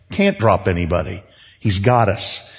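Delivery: ordinary talk, nothing marked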